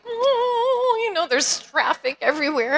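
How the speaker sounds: a long, high cry of exasperation; exasperated oh. Retold or imitated. Driver stuck in freeway traffic, groaning high.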